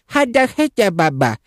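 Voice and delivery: put-on high-pitched baby gibberish; imitating a babbling toddler; toddler babble. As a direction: babble like a little one.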